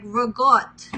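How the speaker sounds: slow Staten Island rigot, clipped final vowel; Staten Island accent. Shown as a demonstration. Vlogger mimics Staten Island way of saying ricotta. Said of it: "if you're from Staten Island".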